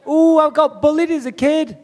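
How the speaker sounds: high-pitched mocking whine at a rival; mock self-pity. Fighter ridiculing his opponent's bullying story, mock self-pity.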